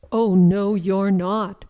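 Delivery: loud, forceful retort; big billy goat standing firm, loudly; defiant big goat. A narrator's reading. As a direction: boom it back at the troll. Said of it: "said Big Billy Goat Gruff".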